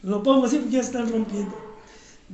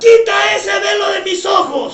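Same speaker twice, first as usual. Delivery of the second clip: much louder, calling out each line; shouted prayer lead; 6 semitones higher, 12 dB louder than usual. Real, not acted. Pastor leads the standing congregation line by line, shouting. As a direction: shout the prayer for the church to echo.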